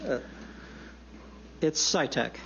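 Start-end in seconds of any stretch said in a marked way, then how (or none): 1.6-2.3 Sitek said SIGH-tek, clearly spelled out; correct name pronunciation. Shown as a demonstration. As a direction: Sitek with the "t" sounded. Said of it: "my mistakes in pronouncing your name".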